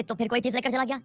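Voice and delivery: retold high-pitched telling-off; mock-annoyed, high accusing question; shopkeeper's scolding. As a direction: scold a thieving child, pitch jumping up. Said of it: "दुकानदार उसको फिर डांटता है".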